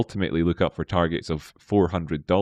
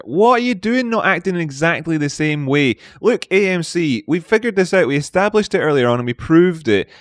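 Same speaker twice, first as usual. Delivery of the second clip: high, raised-voice telling-off; mock scolding; 7 semitones higher, 4 dB louder than usual. Streamer telling off AMC for not copying its twin, mock scolding. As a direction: scold it in playful exasperation.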